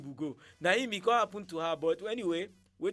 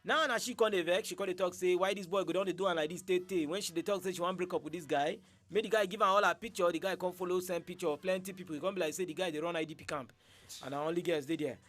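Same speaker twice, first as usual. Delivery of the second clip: Nigerian Pidgin accent, easy recap; 3 dB louder than usual. Real, not acted. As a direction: Nigerian Pidgin, relaxed podcast delivery. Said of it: "speaking Nigerian Pidgin throughout".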